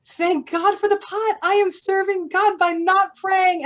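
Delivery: high and slow with delight; a sudden gleeful relief, drawn out; joyful realisation. Retold or imitated. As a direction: Zusha thrilled he's serving God. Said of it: "get up with joy and glee".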